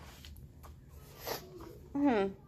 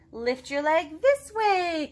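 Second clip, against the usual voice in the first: high, slow, sing-song instruction; teaching Piggy; 5 semitones higher, 5 dB louder, slower than usual. Acted. Piggy slowly shows Gerald the arm move. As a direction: draw out each word like a dance teacher.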